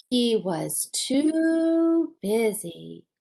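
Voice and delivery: slow, leaning on too; slow refrain. Acted. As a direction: stretch the refrain out. Storybook refrain, drawn out.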